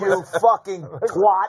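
high, slow, drawn-out swear; mock-angry insult. Gambler insulting the dealer in the story, mock anger.

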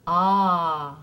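long, drawn-out "ah" with dropped jaw; open "ah" vowel. Shown as a demonstration. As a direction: hold "ah" slowly, jaw open. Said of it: "take it from the ah sound".

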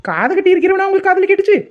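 high, indignant echoing question; mock disbelief. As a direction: feign amazement at what she misheard. Mocks mother-in-law's mishearing in quarrel.

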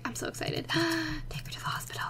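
whispered, with a gasp between; hushed, tense excitement; nervous whisper. Real, not acted. Waiting for the baby to come, nervous whisper. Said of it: "I'm legitimately nervous for this".

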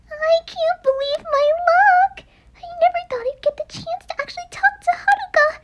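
high, near-tearful giddy gush; can barely contain the joy; starstruck, voice cracking with happiness; overjoyed. Acted. Fan overjoyed at meeting idol.

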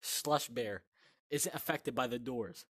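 usual speaking voice

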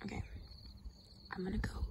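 hushed whisper so frogs stay. Real, not acted. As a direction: sneak it out, barely voiced. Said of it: "I gotta be quiet".

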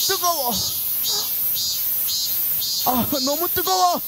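high, complaining groans about the heat; overheated. Acted. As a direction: whine as if baking in the sun. Jonah suffering in the heat.

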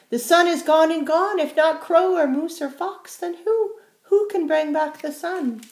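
high, loud little bird; high-voiced chickadee questioning; worried chickadee. A narrator's reading. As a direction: ask it urgently as a small bird.

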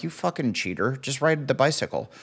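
soft grumbled jab; muttered insult. Retold or imitated. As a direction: grumble it under your breath. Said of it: "I'm like".

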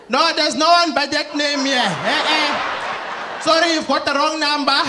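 put-on high, accented denial from a parent; parent at door. Parent answers a friend seeking the son, high-pitched denial. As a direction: turn the caller away in a high put-on voice.

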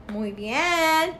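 ordinary delivery

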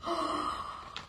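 soft, voiceless story gasp; startled gasp. Acted. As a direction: gasp as if discovering something startling. William finds a lion in bed.

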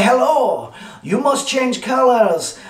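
high, slow hammed-up French accent. The Canvas Hub talks to the printer, hammed-up French accent. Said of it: "This is a French accent".

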